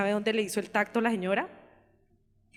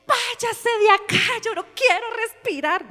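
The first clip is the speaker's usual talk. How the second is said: labour tantrum; 11 semitones higher, 4 dB louder than usual. Retold or imitated. The daughter in labour snaps at Henry, high and loud. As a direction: shove him away, refusing to breathe.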